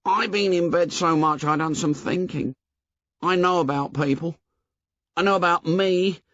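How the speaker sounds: put-on high, anxious boy's voice; fretful, insistent Piggy; worried plump boy pleading. A narrator's reading.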